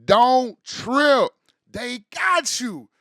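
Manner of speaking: big, booming don't trip; loud reassurance. Acted. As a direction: punch it out loud and slow. Repeat of reassurance, punched up.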